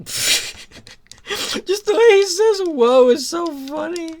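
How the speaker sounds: high, slow, giggly words; can barely get it out for laughing; laughing while talking. Real, not acted. Gamer giggling about the character saying whoa.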